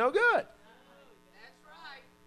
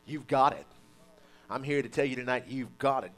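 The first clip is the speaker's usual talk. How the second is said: ordinary delivery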